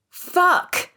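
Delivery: sudden high shout of pain. A narrator's reading. Woman falls on the curb and shouts in pain, high and loud. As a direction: yell out as the ankle gives. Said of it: "I shout".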